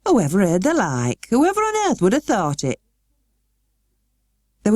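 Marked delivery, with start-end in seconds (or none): 0.1-2.8 Yorkshire housekeeper, rising in disbelief; amazed woman talking to herself; higher, astonished Yorkshire woman. A narrator's reading. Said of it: "she was so astonished".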